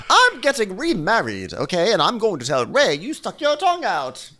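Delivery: high, theatrical put-on voice; hammed-up imitation, self-important; Karen. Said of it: "Karen's response is".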